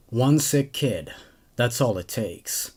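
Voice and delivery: ordinary delivery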